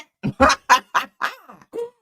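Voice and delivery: loud high laugh at the meme. Real, not acted.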